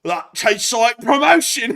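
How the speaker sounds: high, loud hammed-up soldier, slowly; hammed-up Russian soldier boasting; mock Russian soldier. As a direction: ham up a drunk soldier's brag. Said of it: "He says".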